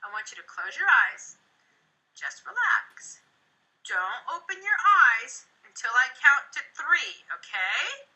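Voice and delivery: slow, calm and soothing. Shown as a demonstration. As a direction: settle the kids down gently. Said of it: "I want to kind of, um, reset".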